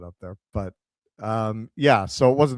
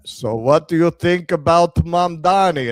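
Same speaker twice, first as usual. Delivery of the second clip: put-on loud, higher-pitched Israeli accent; 4 semitones higher, 7 dB louder than usual. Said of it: "that's when I realized he was Israeli".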